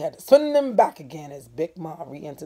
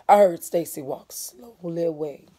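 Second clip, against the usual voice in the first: usual speaking voice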